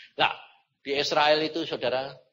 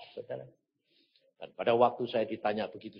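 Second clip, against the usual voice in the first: normal talking voice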